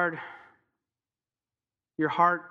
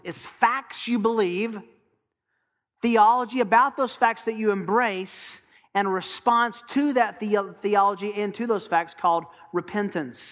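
higher, louder, fervent preaching; preaching at full force; 4 semitones higher, 5 dB louder than usual. Acted. Pastor urging the congregation, fervent loud preaching. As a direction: drive it home to the congregation.

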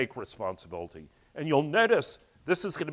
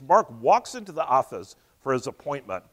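ordinary delivery

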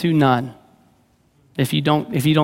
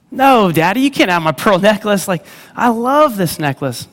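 put-on higher, insistent child's no; stubborn little girl; 5 semitones higher than usual. Jenny refusing to give her daddy the necklace, insistent child voice. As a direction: dig in and refuse like a small kid.

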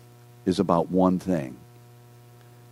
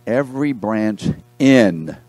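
slow run-up, then a louder, held "in"; stress on IN; 4 dB louder, slower than usual. Real, not acted. Preacher has class circle the word, stressing "in". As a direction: land hard on "in" and stop. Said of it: "circle the word in".

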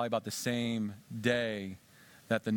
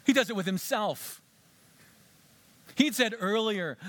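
usual speaking voice

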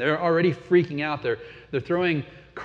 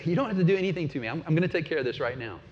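rushed eager offer; much faster than usual. Retold or imitated. Imagined eager prophet Jonah.

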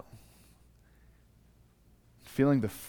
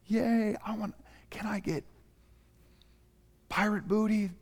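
put-on excited kids; 10 semitones higher, 3 dB quieter than usual.

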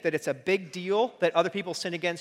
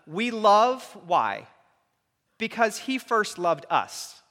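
each word drawn out, slowed right down; slow emphatic; 4 semitones higher, 4 dB louder, much slower than usual. Real, not acted. Preacher to congregation, slow and emphatic. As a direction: lay out the verse slowly, letting it land. Preacher drawing out a key verse.

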